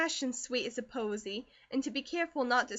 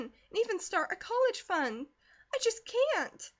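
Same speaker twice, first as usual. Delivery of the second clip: pleading; 8 semitones higher, 4 dB louder than usual. A narrator's reading. Girl pleading, upset about swamp.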